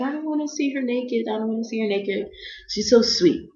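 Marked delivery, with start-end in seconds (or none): 0.0-2.3 high, soft, modest protest; softly mimicked squeamish refusal; imitated host mother. Voicing the sweet host mother.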